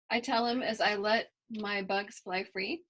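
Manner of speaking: ordinary delivery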